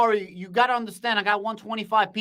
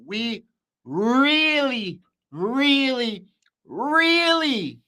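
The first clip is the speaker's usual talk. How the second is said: each really climbs higher, slow and stretched; building excitement; 6 semitones higher, much slower than usual. Real, not acted. Streamer gushing to chat about the market, building excitement. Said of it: "sorry if I'm getting a little bit excited".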